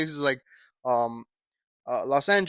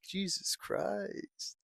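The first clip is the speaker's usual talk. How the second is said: slow, soft exasperated sigh of words; exasperated mutter; 4 semitones higher, 14 dB quieter, much slower than usual. Real, not acted. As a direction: drag it out under your breath. Frustrated at fumbling the intro.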